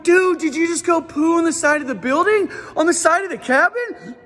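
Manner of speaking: high, loud, alarmed yelling; loud panicked dad voice; freaking out. Retold or imitated. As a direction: yell at the kid in disbelief. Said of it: "I'm so loud because I'm freaking out".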